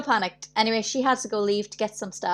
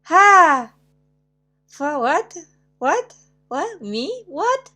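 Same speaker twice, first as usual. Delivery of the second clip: high, incredulous "What?" over and over; baffled surprise; 7 semitones higher, 7 dB louder, much slower than usual. Retold or imitated. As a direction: act stunned by the message. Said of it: "And I'm like".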